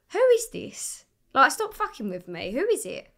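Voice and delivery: high, loud, demanding challenge; retold exasperation. Singer challenging a suspected prank caller, exasperated. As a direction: confront the prank caller, voice jumping up.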